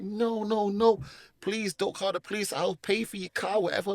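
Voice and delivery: re-enacted anxious plea; retold pleading. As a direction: plead your way out of trouble. Retold begging not to call police.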